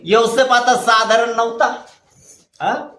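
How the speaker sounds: ordinary delivery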